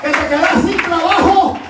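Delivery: high, insistent sermon questions; full-force preaching. Real, not acted. Preacher challenges congregation on what anointing is, preaching at full force.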